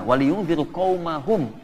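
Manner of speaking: very slow recited Arabic verse; Quran recitation. Acted. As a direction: draw the verse out slowly. Quotes Quran verse again.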